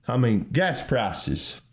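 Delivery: usual speaking voice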